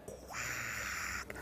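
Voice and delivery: voiceless, whispered quack; barely audible whispered quack; tiny duck quack. Acted. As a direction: let out a quack on breath alone. Fourth duck's barely audible quack.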